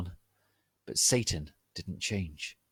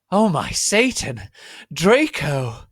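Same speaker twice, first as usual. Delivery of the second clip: high, loud hammed-up cry; screamed; 8 semitones higher, 10 dB louder, slower than usual. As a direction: shriek his name in rapture. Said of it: "I screamed".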